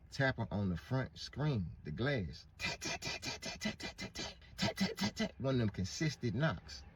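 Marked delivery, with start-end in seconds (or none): 2.6-5.3 knocking imitation.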